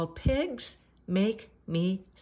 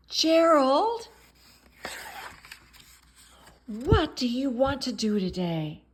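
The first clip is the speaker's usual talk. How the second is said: high, loud, bright Piggy calling to her friend; Piggy's voice; 5 semitones higher, 6 dB louder than usual. Acted. Piggy greets Gerald, high and loud. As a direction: call out high and loud like an eager little pig.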